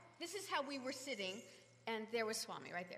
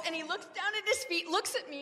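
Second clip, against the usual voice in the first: usual speaking voice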